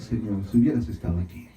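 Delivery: low, soft voiced quote; hushed, accepting aside; different, humbler attitude. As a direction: drop down low and soft, as if submitting to the Lord. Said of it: "pero con otra actitud le dice".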